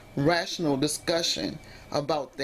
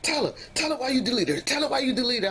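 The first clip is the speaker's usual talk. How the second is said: high, fast mock of his insistent demand; imitating partner demanding; 8 semitones higher, faster than usual. Imitating partner ordering his best friend on the phone. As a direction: push the friend to explain, pitched up and quick. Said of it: "he was like".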